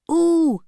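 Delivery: put-on high rising ooh of wincing kids; twins' ooh. A narrator's reading. Twins watching the fight, wincing ooh. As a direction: wince at the blow. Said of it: "cried the twins together".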